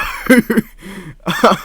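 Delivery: high burst of laughter; cracking up out loud. Real, not acted. Laughs at his own point.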